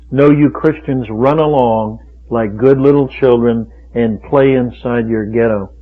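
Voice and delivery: normal talking voice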